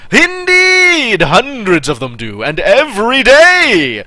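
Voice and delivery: loud, high, hearty showman voice; booming exhibitor. Acted. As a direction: boom it out like a proud exhibitor. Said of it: "he replied with a booming laugh".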